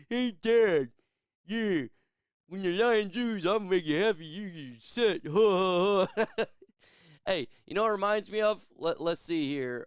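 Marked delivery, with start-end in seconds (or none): 0.1-6.4 put-on higher, louder taunting kid voice; imitating his kid. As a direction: tease Dad like a cheeky eleven-year-old. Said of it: "my eleven-year-old say to me".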